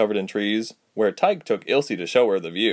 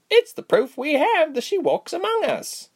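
much higher eager voice; excited. A narrator's reading. Berg's sister continuing to the guests, excited. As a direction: keep the eager rush.

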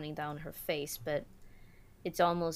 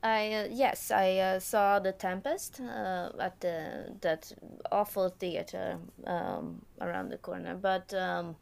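put-on halting, louder mixed German-Swedish accent; broken German-Swedish-English accent; 3 semitones higher, 4 dB louder than usual.

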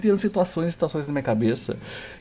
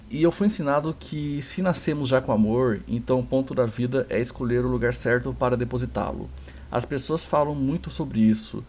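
measured, lower-pitched monologue reading; dramatic recitation; 6 semitones lower than usual. Acted. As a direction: recite it solemnly, like a speech from a script. Recita o discurso do padre. (recitação solene de monólogo)

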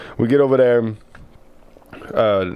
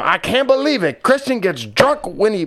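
imitating grandma; 13 semitones higher than usual. Grandson voices grandma scandalised by the vodka news, high. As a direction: squeal it up, scandalised old lady.

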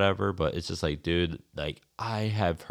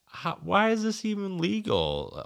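much higher, indignant disbelieving question; incredulous outrage; 13 semitones higher than usual. Retold or imitated. Complaining to his co-host about horses on public land, high incredulous protest. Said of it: "And I was just like".

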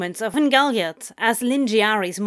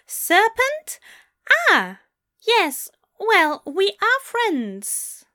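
high British voice, awkwardly drawn out at the end; polite discomfort; 11 semitones higher, 4 dB louder, slower than usual. A narrator's reading. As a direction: concede it slowly, politely uneasy and British. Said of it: "with a degree of polite discomfort".

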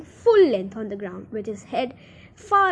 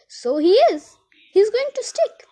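put-on excited boy; 9 semitones higher, 7 dB louder than usual. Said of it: "said Charlie".

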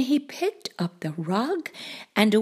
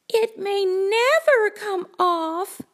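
put-on high, slow little-girl voice; high-pitched, dismayed child; worried Sally; 10 semitones higher than usual. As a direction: draw out the worry up high. Sally frets in story.

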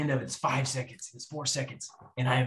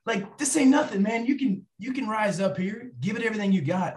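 pumped-up brother pep talk; 8 semitones higher than usual. Retold or imitated. Brothers pep talk before the course.

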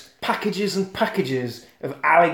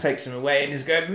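ordinary talk

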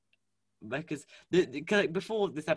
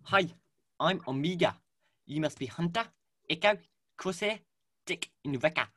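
higher New Zealand child-character impression, each name set apart; Omega; 4 semitones higher, 4 dB louder than usual. Omega greeting the clones by name, New Zealand accent. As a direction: put on a Kiwi accent, pausing between names. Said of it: "should I do my Amiga impression".

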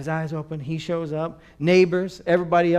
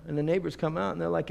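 ordinary delivery

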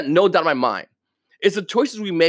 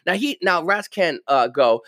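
ordinary delivery

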